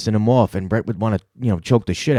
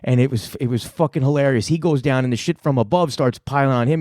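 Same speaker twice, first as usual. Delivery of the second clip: normal talking voice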